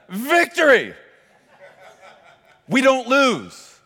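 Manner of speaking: high, loud triumphant preaching; preaching at full force. Acted. Preacher crying out victory to his congregation, loud and high. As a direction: build to a shouting climax.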